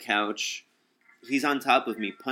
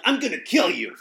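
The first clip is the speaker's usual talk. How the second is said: raised, louder threat; angry threat; 8 semitones higher, 7 dB louder than usual. Retold or imitated. Fighting back on the couch, angry louder threat at the robber. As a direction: spit the threat out angrily. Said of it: "I'm angry at this man".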